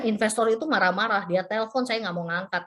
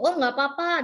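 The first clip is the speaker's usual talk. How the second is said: higher-pitched, easygoing quoted reply; delighted reassurance; 6 semitones higher than usual. Retold or imitated. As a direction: imitate a developer happily waving it off. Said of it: "disambut dengan sangat sukacita oleh pihak developer".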